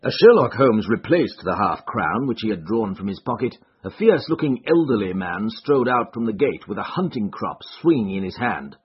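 ordinary delivery, nothing marked

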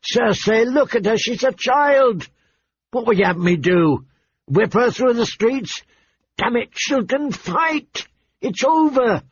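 high-pitched, incredulous protest; exasperated king. A narrator's reading. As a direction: argue it away as an exasperated husband. King angrily dismisses queen's demand.

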